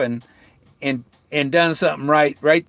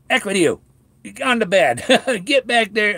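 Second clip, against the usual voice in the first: higher, mock-stern telling-off of the dog, laughing at the end; scolding the dog; 7 semitones higher than usual. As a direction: shoo the begging dog away, half laughing. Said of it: "And I said".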